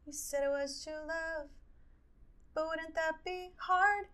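high, slow put-on lyric delivery; a hint of sung melodrama; quoting a dramatic line. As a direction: drag the misremembered lyric out. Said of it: "What the fuck's the line?".

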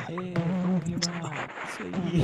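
lightly voiced rap hype man. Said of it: "tu fala".

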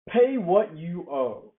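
slow, loud, hammered demand; harsh demand. Acted. Servant choking his debtor, slow loud demand. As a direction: spit out each word of the demand.

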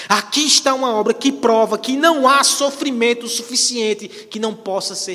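loud, high-pitched pulpit proclamation; fervent preaching. Acted. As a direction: preach it out at full force. Preaches at full force.

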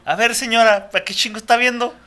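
mock telling off. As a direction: pretend to scold the nosy neighbor. Voicing yelling at neighbor.

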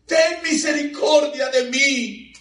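high, loud, slow plea; slow, high, desperate cry; crying out for mercy. Retold or imitated. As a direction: let the whole crowd hear her begging. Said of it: "que todo el mundo se dio cuenta".

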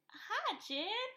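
high, cutesy mock-excited hi; hammed-up girlish delight; giddy greeting. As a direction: imitate a smitten woman greeting her date. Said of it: "she's so excited to see Jed".

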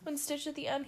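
normal talking voice

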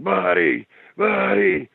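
high, urgent call of the dog's name; yelling to get the dog to turn around. Retold or imitated. Retelling calling his dog away from the tree, high urgent yell. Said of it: "I started screaming for Buddy".

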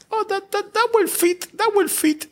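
high-pitched mimicked woman's voice; imitated woman passenger. Passenger to driver about her luggage, high mimicked voice.

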